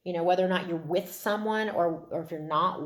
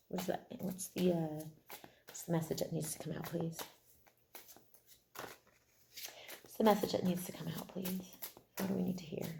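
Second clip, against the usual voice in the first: hushed, asking the cards; soft questioning; 9 dB quieter, faster than usual. Real, not acted. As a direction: quietly ask the deck what it says.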